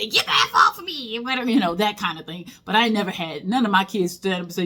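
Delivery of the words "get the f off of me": higher, mock-angry kid's snap; mock kid yelling. Kid pulled out of a fight snaps at the teacher. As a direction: play a kid yanking free in a hallway fight. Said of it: "the kid".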